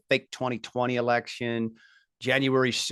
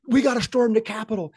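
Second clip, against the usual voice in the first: higher, louder call to storm in; mock rabble-rouser; 9 semitones higher, 3 dB louder than usual. Retelling Ray Epps urging the crowd, mock rallying cry. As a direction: imitate an agitator egging on the crowd. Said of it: "Ray Epps, as you famously remember".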